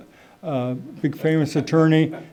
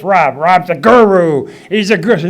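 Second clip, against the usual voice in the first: deep-voiced dad; 7 dB louder than usual. Dad bragging about Rob in a mock deep voice. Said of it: "my dad had that deep voice like this".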